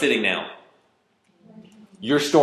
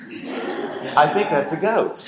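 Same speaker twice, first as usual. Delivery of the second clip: slow, higher-pitched mock wondering; mock mild musing; 5 semitones higher, much slower than usual. Shown as a demonstration. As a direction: draw it out as a hesitant, unbothered guess. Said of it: "what's the feel of".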